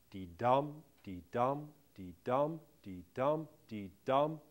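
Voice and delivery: "dee-dum" with weight on "dum"; even pulses, light "dee" and strong "dum"; iambic beat. Shown as a demonstration.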